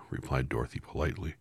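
plain reading voice

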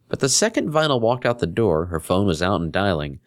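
ordinary delivery, nothing marked

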